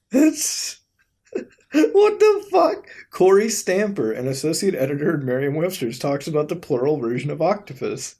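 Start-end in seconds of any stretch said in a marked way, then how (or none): 0.1-2.9 high, slow squeal of laughing disbelief. Real, not acted. Vlogger cracking up at a dictionary octopus video, high laughing disbelief.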